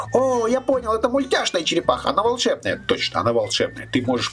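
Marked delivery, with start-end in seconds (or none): 0.1-2.8 put-on little boy voice. Puppet Leo chats with host.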